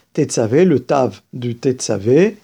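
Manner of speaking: ordinary talk, nothing marked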